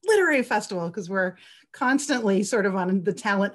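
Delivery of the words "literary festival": a bright, loud flash of an idea; excited inner thought. Retold or imitated. Recalling her first thought on hearing of the book, loud and excited. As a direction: blurt it out like a sudden brainwave. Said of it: "I was like".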